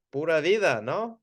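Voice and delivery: high-pitched "Pura vida, no?" asking for approval; playful rising "no?"; playful question. Shown as a demonstration. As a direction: tease a check that it sounded right. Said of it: "Or sometimes I might say".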